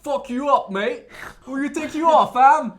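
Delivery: hammed-up British tough-guy accent. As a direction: shout it like an English lad looking for a fight. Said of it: "c'est l'accent le plus sexy".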